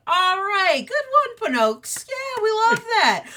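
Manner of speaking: high, loud cheering dad; Big Dad Wolf. Acted. Wolf dad applauding Pinocchio's story, loud high-pitched enthusiasm. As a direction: whoop encouragement at the young storyteller. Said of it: "Big Dad Wolf is like".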